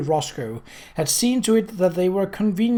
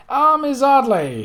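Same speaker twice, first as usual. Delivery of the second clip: higher, louder, slow drawn-out "Ah"; effusive greeting; 10 semitones higher, 6 dB louder than usual. A narrator's reading. Marston greets Kate with a slow, high, gushing flourish. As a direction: gush over her name like a suitor.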